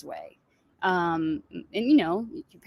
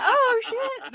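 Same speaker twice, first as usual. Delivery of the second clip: stunned; 13 semitones higher than usual. Retold or imitated. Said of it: "I was like".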